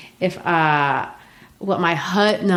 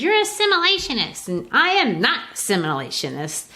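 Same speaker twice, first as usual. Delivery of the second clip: high, fast, accusing African suitor; voicing Assegai; 6 semitones higher than usual. Assegai teasing Beneatha about assimilating, in a high mock voice. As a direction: toss the charge at her like a teasing suitor.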